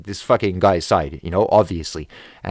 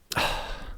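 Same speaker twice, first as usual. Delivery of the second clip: heavy voiceless exhale; exasperated sigh; 10 dB quieter than usual. Real, not acted. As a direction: let out a real, frustrated breath. Pauses while reliving the argument.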